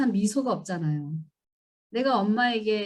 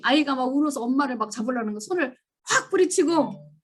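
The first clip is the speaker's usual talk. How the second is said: ordinary talk, nothing marked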